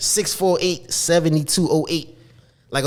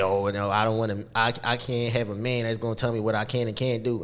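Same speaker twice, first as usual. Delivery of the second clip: mock independent woman; 7 semitones lower, 9 dB quieter than usual. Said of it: "some people say".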